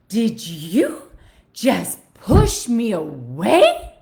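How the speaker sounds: loud, slow, stunned voice rising on push; mock outraged gasp of a man who can't believe it; shocked disbelief. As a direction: play the client, stunned and offended. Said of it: "Just like that".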